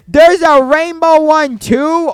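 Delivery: high, loud and slow excitement; thrilled at a rare find; excited. Real, not acted. Spots a rainbow fruit.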